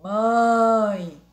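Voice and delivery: "Mãe" drawn out through the nose; nasal vowel. Shown as a demonstration. Teacher has pupils pinch noses, nasalised "Mãe". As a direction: hold the nasal "Mãe", loud. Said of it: "Fechem o nariz e falem mãe".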